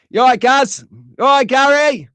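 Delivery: loud, high cheeky call to the boss. Real, not acted. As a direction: hail him across the room. Calling out to his boss.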